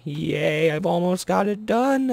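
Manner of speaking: high, delighted squeal of relief; excited. Real, not acted. Gamer nearly finishing his project, squealing with joy. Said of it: "I am so happy".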